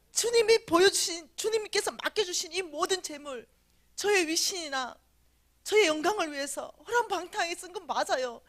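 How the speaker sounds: loud, high begging prayer; desperate pleading. Retold or imitated. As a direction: cry out to God for another chance.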